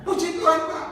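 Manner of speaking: high, imitated elated phone report; excited caller. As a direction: burst out with good news. Meniru penelepon yang bersukacita. (meniru penelepon yang girang)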